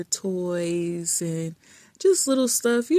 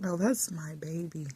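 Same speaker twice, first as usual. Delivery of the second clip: whispered; 7 dB quieter than usual. Real, not acted. Insisting her dog is her baby.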